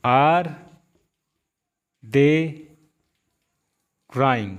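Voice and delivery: each word alone with long pauses; slow classroom dictation. Shown as a demonstration. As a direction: set out one word at a time. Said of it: "अब देखिए".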